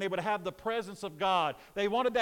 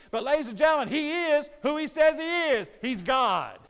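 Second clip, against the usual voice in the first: raised, driving pulpit voice; impassioned preaching; 4 semitones higher, 4 dB louder than usual. Real, not acted. Preacher declaring to the congregation that Jesus is God, impassioned preaching. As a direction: preach it with forceful conviction.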